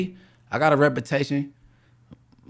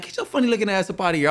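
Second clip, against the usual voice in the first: mama getting mad; 6 semitones higher, faster than usual. Retold or imitated. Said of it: "my mama get mad".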